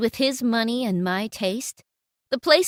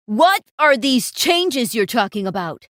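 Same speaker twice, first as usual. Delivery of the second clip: louder, demanding yell; 8 dB louder than usual. Acted. Manager storms in on the staff, yelling. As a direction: bark the question at them. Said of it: "the yelling man".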